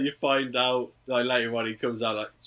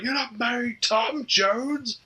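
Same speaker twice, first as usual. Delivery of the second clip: much higher, slow put-on voice; mock stern voice; 11 semitones higher, much slower than usual. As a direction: drag it out in a silly voice. Sergio voiced, slowed.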